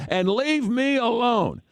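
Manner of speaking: high, slow imitated outburst; mock angry parting shot. Umpire walking off from a heckler, mock indignant outburst. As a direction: draw it out like an exasperated umpire. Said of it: "he dresses me down like no one's business".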